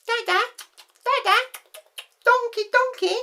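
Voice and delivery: put-on high baby voice calling; high baby pleading for the donkey; toddler babble. Toddler in pushchair points.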